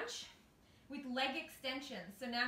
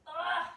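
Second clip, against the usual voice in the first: effortful grunt; 4 semitones higher, 7 dB louder than usual. Real, not acted. Exertion grunt during abs.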